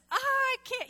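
high, loud stubborn "I can't"; stubborn refusal. Retold or imitated. Stubborn refusal to church friends.

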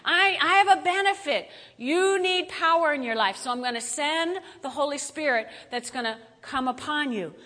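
voicing Jesus. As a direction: speak as Jesus making a promise. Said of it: "But Jesus said".

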